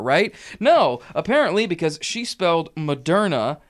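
usual speaking voice

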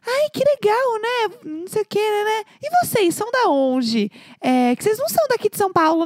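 high-pitched, gushing small-talk voice; mock friendly questioning, high voice; chatty new coworker. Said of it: "falou assim".

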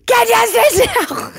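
high, loud exasperated mum shout; mock yell. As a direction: bellow it like a fed-up parent. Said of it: "I'm like".

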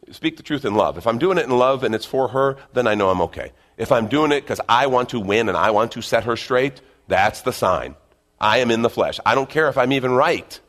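ordinary talk, nothing marked

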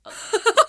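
high delighted burst of laughter; squealing laugh of surprise; surprised laugh. Acted. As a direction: laugh in shock at seeing her. Surprised to see friend.

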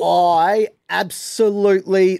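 gushing. Real, not acted. Gushing about Space Jam.

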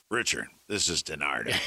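low, far-off caller voice imitating DiNardo; lightly voiced prank caller; DiNardo impression. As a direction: drop low to play the caller. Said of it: "and I hear".

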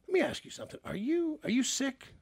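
a high, soft prying question; lightly voiced coworker asking if he's sick; mock coworker voice. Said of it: "She goes".

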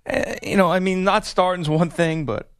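mock tearful athlete, halting with long pauses; half-hearted choked-up interview voice, breaking off mid-thought; fake choked-up crying. Host tries faking a tearful player interview, choked up. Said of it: "let's see if I can".

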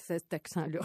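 ordinary delivery

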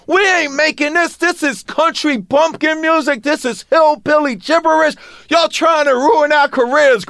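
high, loud mock outrage; hammed-up indignant protest; retelling the outraged phone refusal, pitched high and loud.

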